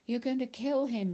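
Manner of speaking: loud, high alarmed shout. A narrator's reading. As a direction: yell out to stop the choking. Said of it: "shouted Mister Pendanski".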